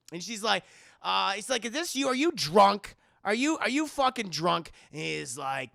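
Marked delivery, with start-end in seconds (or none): 1.7-4.7 higher, louder mock disbelief; incredulous demand. Woman questioning her drunk caller, incredulous. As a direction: challenge him like an appalled girlfriend.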